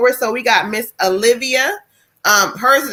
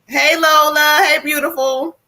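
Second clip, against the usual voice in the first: affectionate greeting; 7 semitones higher than usual. Real, not acted. Greeting a spirit lovingly.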